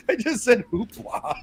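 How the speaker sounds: high, laughing disbelief; laughing at saying hoopla; laughing at self. Real, not acted. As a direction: crack up at your own word. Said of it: "What the hell is wrong with me?".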